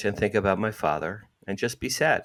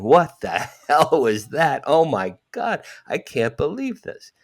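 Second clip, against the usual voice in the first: louder and higher, slow, laughing amazement; shaken laughing disbelief; 6 semitones higher, 4 dB louder than usual. Retold or imitated. Sitting in the deli after the vision, laughing in stunned amazement. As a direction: laugh through the shock.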